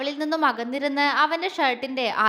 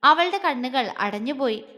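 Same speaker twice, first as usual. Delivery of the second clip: usual speaking voice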